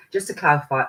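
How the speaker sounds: ordinary delivery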